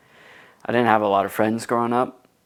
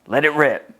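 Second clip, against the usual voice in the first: a hint of the big brother's bold call; lightly voiced brother urging him on; imitating brother; 4 semitones higher, 3 dB louder than usual. Quoting his brother telling him to face his fears, lightly voiced. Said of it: "He would always say".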